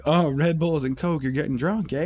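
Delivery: knowing teasing guy. Retold or imitated. One guy teases about the booze.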